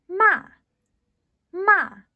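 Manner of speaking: "马" starting high and dropping sharply; fourth tone ma. Shown as a demonstration. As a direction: snap "马" down from high. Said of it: "what these might sound like".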